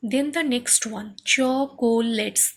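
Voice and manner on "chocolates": "chocolates" slowly broken as cho, co, lets; three separate syllables. Shown as a demonstration. Teacher introducing the next word, "chocolates" syllable by syllable. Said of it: "three syllables".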